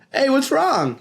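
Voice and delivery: put-on high bright kid; cheerful Frankie. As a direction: jog over smiling. Frankie voiced arriving.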